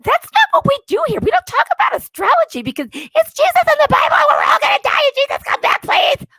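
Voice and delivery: high, loud preachy yelling; preachy shout. Retold or imitated. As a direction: scold the chat like a zealot. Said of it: "And I'm just like".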